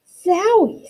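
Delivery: high, startled little zowie; a child's amazed exclamation; surprised exclaim. Acted. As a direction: blurt it out at the sudden smell. Ada exclaims at a stench.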